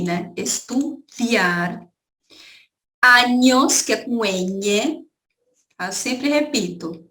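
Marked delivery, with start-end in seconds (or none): 0.4-1.8 Estudiar, very slow, es-tu-di-AR; careful pronunciation. Shown as a demonstration. Teacher stresses the spelling with i for students. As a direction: draw out the i in Estudiar. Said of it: "se escreve com I".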